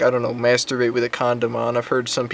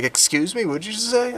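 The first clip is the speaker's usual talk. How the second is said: rude, high-pitched challenge; indignant retort, rising high; 10 semitones higher than usual. Retold or imitated. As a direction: act out an offended demand. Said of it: "Like, really rudely".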